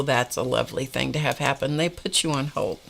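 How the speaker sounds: ordinary talk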